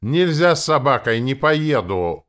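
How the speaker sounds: loud, high, stretched-out shout; shouted refusal. A narrator's reading. Coachman refuses to take the dog, shouting. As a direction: yell a refusal from up on the seat. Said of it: "закричал возница с козел".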